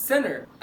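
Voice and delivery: "center" as "senner"; silent T. Shown as a demonstration. Teacher giving the rule's result, silent T. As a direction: leave the T out of "center". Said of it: "قاعده السايلنت تي".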